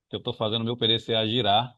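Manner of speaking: usual speaking voice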